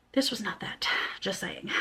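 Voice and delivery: unvoiced whispered jab; whispered aside. Real, not acted. Whispered aside to viewers after reading the review. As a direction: lean in and whisper the dig.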